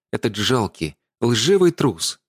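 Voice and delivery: ordinary delivery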